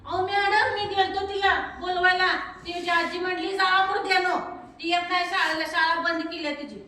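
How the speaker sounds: put-on high, loud excited boy's voice; eager schoolboy calling out. Boy tells madam that Seema's school was stopped, loud and eager. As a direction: blurt it out to the teacher.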